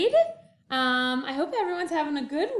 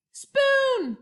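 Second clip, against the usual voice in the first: dramatic reveal; 10 semitones higher, 6 dB louder than usual. Acted.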